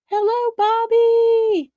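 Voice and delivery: high sing-song hello; singsong greeting. Real, not acted.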